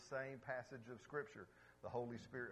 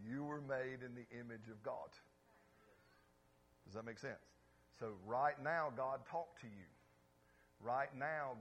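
normal talking voice

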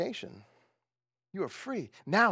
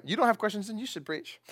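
ordinary delivery

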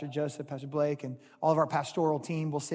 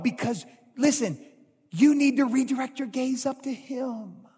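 preaching; 5 semitones higher, 5 dB louder than usual. Acted. Pastor preaching with raised voice.

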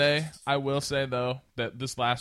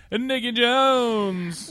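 high, slow drawn-out introduction; grand entrance announcement; 12 semitones higher, 6 dB louder, much slower than usual. Acted. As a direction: stretch the name like a ring announcer. Introducing guest with fanfare.

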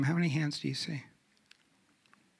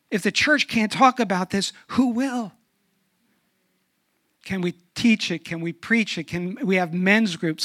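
higher, louder pulpit fervor; fervent preaching; 4 semitones higher, 7 dB louder than usual. Real, not acted. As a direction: drive it home from the pulpit. Preaching at full force.

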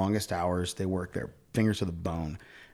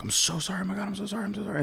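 high, flustered apologizing; a touch of retold panic; mortified apology; 10 semitones higher, 4 dB quieter, much faster than usual. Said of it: "I was like".

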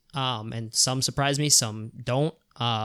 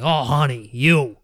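put-on coworker's Boston accent; 6 dB louder, much slower than usual. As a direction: imitate a Boston coworker, hammed up and loud. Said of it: "like, Boston accent".

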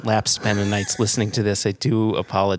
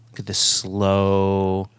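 slow, drawn out; 2 semitones lower, much slower than usual. Real, not acted. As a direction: stretch it out slowly. Drawn-out for effect.

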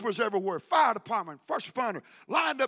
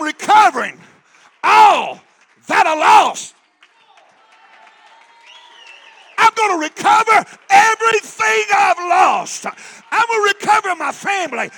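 loud, high shouted declarations; preaching climax; 7 semitones higher, 13 dB louder than usual. Acted. Preacher declaring victory to the congregation, loud high shout. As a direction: lift it to a fiery peak.

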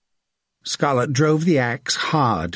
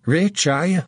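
higher, gloating question; gleeful, pitched-up needling; grinning taunt; 5 semitones higher than usual. A narrator's reading. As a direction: taunt a captive through a grin. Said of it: "He crouched and grinned".

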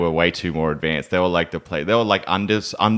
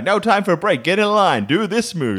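bossy instructor; 10 semitones higher than usual. Retold or imitated. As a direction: play the pushy salsa teacher.

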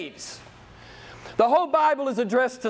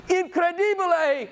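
Italian exclamation; 9 semitones higher than usual. Retold or imitated. Preacher borrowing his Italian brothers' word, in a lively Italian accent. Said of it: "my Italian brothers would say".

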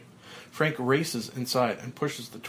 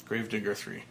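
normal talking voice

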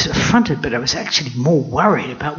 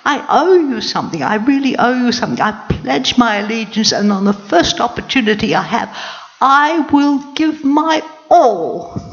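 higher squeaky mouse pledging loyalty; 7 semitones higher than usual. Acted. Grateful mouse pledging allegiance to the queen, squeaky and earnest. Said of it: "And he said".

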